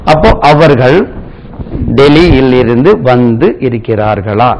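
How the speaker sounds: usual speaking voice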